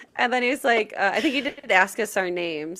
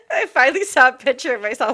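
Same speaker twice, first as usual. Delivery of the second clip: ordinary talk, nothing marked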